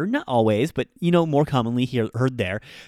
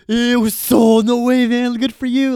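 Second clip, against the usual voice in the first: much higher and louder, delighted shock; excited disbelief; 11 semitones higher, 8 dB louder than usual. Pretend. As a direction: cheer your friend on in amazed joy. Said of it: "And you could be like".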